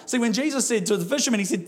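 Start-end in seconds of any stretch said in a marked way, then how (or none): none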